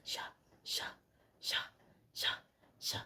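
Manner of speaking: soft rhythmic slicing noise; soft sha-sha cutting sound; imitated slicing. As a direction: mimic a blade cutting meat. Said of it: "That's what I'm hearing".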